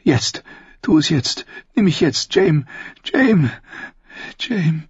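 high, pleading urgency; urgent, impassioned Cersei. Acted. Sister urging her lover on, high impassioned voice. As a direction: urge him on in a high, impassioned voice.

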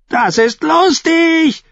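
high, slow, crowing glee; crowing fool. A narrator's reading. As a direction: crow it like a mad jester. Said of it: "krähte Shackwell". (krähend, hoch und langsam)

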